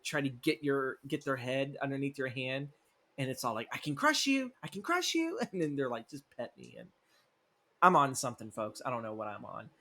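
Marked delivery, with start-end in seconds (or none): 3.7-5.4 much higher mock menace from a cat; a pet cat playing the tyrant, squeaky. Cat voiced by owner, high squeaky mock threat. Voicing the cat's thoughts. 6.1-6.9 much lower, softer cat begging. Retold or imitated. Cat voiced by owner, low soft begging. Voicing the cat's thoughts.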